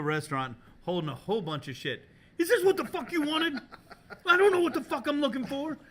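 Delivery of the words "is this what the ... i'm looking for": very high, loud frantic yelling; hammed-up screaming rant; imitated red rage. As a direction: act out a colleague's exasperated meltdown. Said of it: "red rage".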